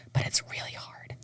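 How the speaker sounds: soft whisper, confiding; whispered aside. Real, not acted. Singer telling interviewer French is hard, whispered aside. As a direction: whisper the catch as a secret.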